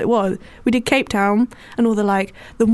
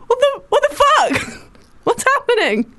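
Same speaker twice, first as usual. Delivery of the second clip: high, slow, disbelieving squeal; can't believe what she hears; shrill disbelief; 13 semitones higher than usual. Real, not acted. Disbelief at his pronunciation.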